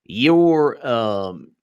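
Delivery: slow, drawn-out Southern questioning; Southern congressman. Acted. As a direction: drawl it like a Southern congressman at a hearing. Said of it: "one of the Southern congressmen's like".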